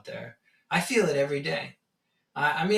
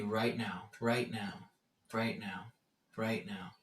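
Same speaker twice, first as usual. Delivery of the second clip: each repeat softer and lower; fading repetition; 5 semitones lower, 8 dB quieter, slower than usual. Acted. Teacher dwelling on the present moment, fading repetition. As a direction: let the words gradually die away.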